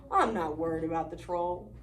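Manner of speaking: low, calm big goat voice. Acted. Big goat reassuring brother, low and calm. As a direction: brush it off deep and steady. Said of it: "said Big Billy Goat Gruff".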